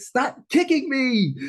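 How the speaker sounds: hammed-up irritation at the dancers; mock anger. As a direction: snap at the crowd that keeps kicking. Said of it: "I'm angry".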